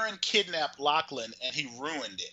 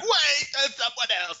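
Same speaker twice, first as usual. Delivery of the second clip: mock sudden conscience; 11 semitones higher, 5 dB louder than usual. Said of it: "he was like".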